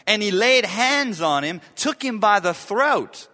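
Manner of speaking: loud, high, intense reading of the parable; loud, forceful preacher reading. Acted. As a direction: push it out with force.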